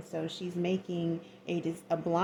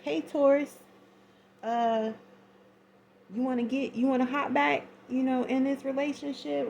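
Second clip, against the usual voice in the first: voicing a suitor; 6 semitones higher, 4 dB louder than usual. Tarot reader voicing suitor's text.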